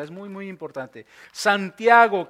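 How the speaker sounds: normal talking voice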